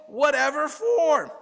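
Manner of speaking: high, slow disbelief; can't believe it; incredulous. Acted. Rabbi incredulous.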